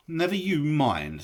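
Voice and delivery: a hint of a brisk hobbit brushing it off; curt hobbit reply; Bilbo, curt. A narrator's reading. Bilbo fending off Gollum's question, curt.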